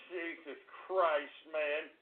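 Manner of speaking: low, hushed, slow oath; weary despair. Real, not acted. Host fed up with trolls, weary despair. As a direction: let it drop, utterly drained.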